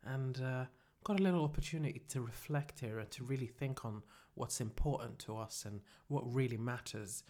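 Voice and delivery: low, soft self-help voice; hushed, earnest mantra leader; mock guided reflection. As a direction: gently guide them into solemn reflection.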